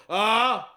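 loud, high wordless blurt; mock blurted "ah". Man not wanting to talk, loud dismissive "ah". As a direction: cut off the chat with a loud "ah". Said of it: "the guy would just go".

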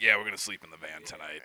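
low, fast mock [name] voice; low imitation. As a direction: drop low to imitate a bandmate. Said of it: "[name]'s like".

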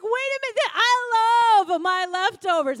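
mock-wounded man defending his leftovers; hammed-up husband protest; whiny husband voice.

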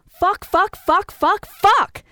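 high, loud, punched-out yells; top-of-lungs yell. A narrator's reading. Girl revels in new swear word, yelling. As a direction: yell each one, building to the last. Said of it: "I yelled at the top of my lungs".

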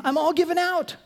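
mock exhausted. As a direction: whine that you're spent. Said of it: "God says".

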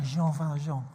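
Jean Valjean in a French accent; French pronunciation. Shown as a demonstration. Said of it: "you have to say it like that".

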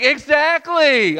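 high, emphatic drawn-out retort; acted pointed comeback; God. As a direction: throw it back loudly and slowly. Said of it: "And then God's like".

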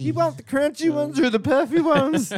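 high sugary granny offer; grandma offering snacks. Pretend. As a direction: coo it like a doting grandma. Playing Meemaw.

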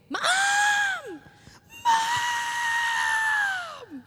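put-on very high, loud toddler scream of "Mom!". Foster son screams for mom from his crib, high and loud. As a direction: shriek it like a two-year-old in his crib. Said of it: "he started out screaming".

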